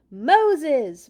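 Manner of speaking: God calling from the burning bush, booming; booming divine voice. Acted. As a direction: boom it out like a voice from the bush. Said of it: "boomed a big voice".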